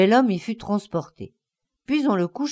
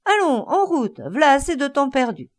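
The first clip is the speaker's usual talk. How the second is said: much higher shout to move on; calling out; 5 semitones higher than usual. Acted. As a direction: call them back to the coach. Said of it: "il cria".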